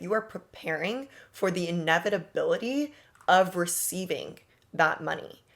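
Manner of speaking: ordinary delivery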